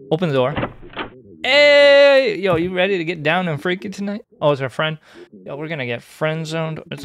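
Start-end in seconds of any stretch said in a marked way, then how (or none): 1.4-4.2 flirty greeting. Acted.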